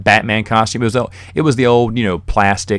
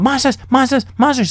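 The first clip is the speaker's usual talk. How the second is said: put-on scared little kid. Scared little brother crying out.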